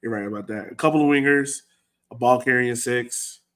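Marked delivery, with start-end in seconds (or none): none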